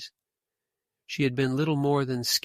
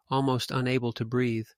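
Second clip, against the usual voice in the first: ordinary delivery, nothing marked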